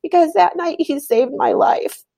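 real crying while telling the story. Real, not acted. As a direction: speak while holding back sobs. Obviously I'm crying about it.

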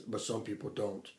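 low, hushed computerized villain voice; Ultron. Retold or imitated. Quoting the trailer's last line to a friend, low computerized voice. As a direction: drop it low and very soft, like a machine. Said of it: "It's a computerized".

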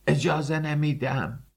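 high, tight screech of refusal; shriek through clenched teeth. A narrator's reading. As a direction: screech it through a locked jaw.

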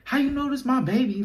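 high, incredulous retelling of his own protest; squeaky high how-could-that-be; high disbelieving quote. Retold or imitated. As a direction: pitch it way up in disbelief. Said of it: "I'm like".

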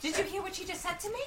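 high mock-indignant voice; playfully offended; mock outrage. Real, not acted.

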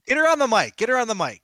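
loud excited call. Real, not acted. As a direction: shout it across the room. Calling out for Katie.

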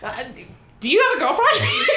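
high, giggly, flustered stammer; nervous stammer. Retold or imitated. Asking the hot guy with friend's words, nervous stammer. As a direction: stammer the question out in mock nerves.

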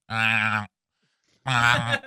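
drawn-out gurgling, buzzy mumble; mumbling country doctor. Retold or imitated. Doctor stalls patient on phone, mumbling impression. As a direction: imitate a man yammering with no words. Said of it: "Did you just throw up".

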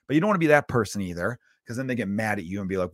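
ordinary delivery

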